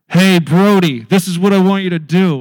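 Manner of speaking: loud, commanding divine announcement; booming voice from above. Retold or imitated. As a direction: boom it down like a voice from heaven. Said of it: "It's gonna sound like that".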